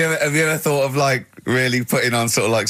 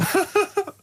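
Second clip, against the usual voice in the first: a high burst of real laughter; high squeaky laugh; 3 dB quieter than usual. Real, not acted. Laughing high at the absurd lift story.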